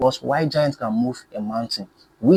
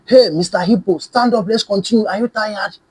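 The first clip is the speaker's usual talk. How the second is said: taunting; 8 semitones higher, 4 dB louder than usual. A narrator's reading. Tortoise taunts tired hippo.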